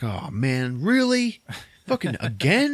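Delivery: incredulous at being double-crossed again; exasperated disbelief. Retold or imitated. Billy Bob to Marcus at gunpoint, high disbelief. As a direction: voice leaps high on really, then stays up. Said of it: "Billy Bob is like".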